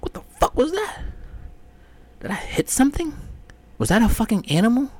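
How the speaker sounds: high, panicky questions; worried he hit something; alarmed questions. Retold or imitated. Driver wondering if he hit an animal, high alarmed.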